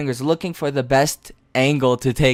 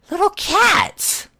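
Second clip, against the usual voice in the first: cutesy voice; much slower than usual. Acted.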